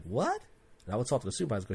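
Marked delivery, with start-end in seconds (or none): 0.0-0.4 high rising retold disbelief; mock taken-aback question; incredulous. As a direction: shoot the word up like you can't believe it. Retold disbelief at being sent back.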